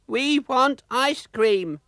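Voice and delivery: high, slow whining wail; wailing children. Acted. The children nagging their parents for ice cream, a high slow wail. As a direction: whine it like bored kids. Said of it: "wailed the children".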